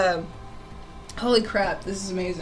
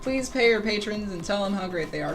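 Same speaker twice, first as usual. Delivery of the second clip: normal talking voice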